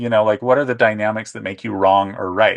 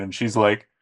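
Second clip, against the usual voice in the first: normal talking voice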